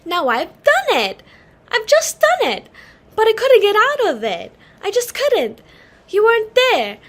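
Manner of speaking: high, loud, near-tearful blurting; flustered daughter confessing her blunder, rising distress; distressed outburst. Acted. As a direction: spill it out in a panic, loud and high. Daughter panics over guest mix-up.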